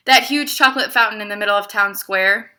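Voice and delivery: lively read-aloud to a class; story narration. A narrator's reading. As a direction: read it like a picture book for kids. Teacher reads picture book aloud.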